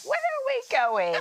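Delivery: high-pitched mock wail; exaggerated dismayed squeal, played for laughs; retold dismay. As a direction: cry it out high, in comic alarm. Retold dismay at worsening scenery.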